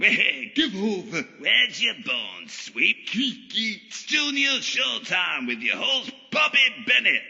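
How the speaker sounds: very high, hammed-up compere voice; shrieky end-of-the-pier showman patter; manic showtime host. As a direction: announce like a crazed variety host.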